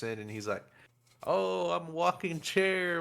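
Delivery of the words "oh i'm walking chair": slow, higher goofy put-on voice; mock cartoon character, slow and raised; playful chair character. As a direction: draw it out like a silly talking chair.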